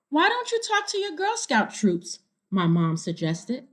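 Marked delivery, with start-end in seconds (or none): 0.1-2.2 lightly higher, gentle mom voice; voicing mom. Mom suggesting to her daughter, a light higher mom voice. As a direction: suggest it like a kind mother.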